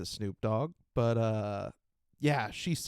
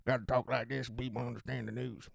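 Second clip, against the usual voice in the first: Mississippi newscaster; 3 dB quieter than usual. Shown as a demonstration. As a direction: demo of a Mississippi newscaster accent. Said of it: "They have to go something like this".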